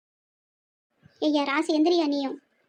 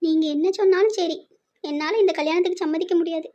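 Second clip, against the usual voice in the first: ordinary talk, nothing marked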